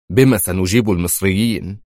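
ordinary delivery

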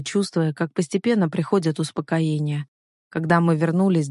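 usual speaking voice

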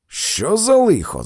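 high, loud cry of alarm; startled shout. A narrator's reading. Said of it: "закричав я".

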